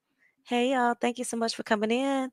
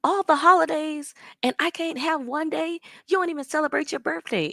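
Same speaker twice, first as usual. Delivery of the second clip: higher, imitated indignant rant; mock upset complaint; 7 semitones higher than usual. Retelling her complaint about birthdays, mock indignant. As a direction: play her grievance back, pitched up. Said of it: "She was like".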